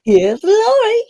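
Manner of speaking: high, loud, slow host announcement; announcer intro. Shown as a demonstration. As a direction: belt it like a game show host. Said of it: "Linda again".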